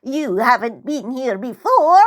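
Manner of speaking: put-on high, slow, barking old crone; barking old woman. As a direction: snap it out high and suspicious. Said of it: "she barks".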